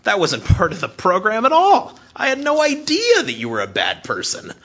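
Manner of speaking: a high, loud, hammed-up startled God; lightly voiced God, shocked at a bad person; mock shocked God. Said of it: "God's going".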